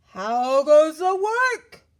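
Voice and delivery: slow, loud drawn-out call; calling farmer. A narrator's reading. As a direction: drag the words out, bossy and lazy. Farmer calls again.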